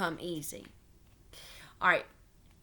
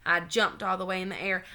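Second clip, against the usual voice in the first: ordinary delivery, nothing marked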